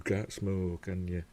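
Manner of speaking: ordinary talk, nothing marked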